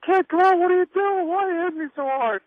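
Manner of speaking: high, loud mock yell at the kid; retold yelling. Veteran wrestler yelling at the young kid mid-match, high and loud mock yell. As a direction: play the veteran yelling in complaint. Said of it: "he starts yelling at me".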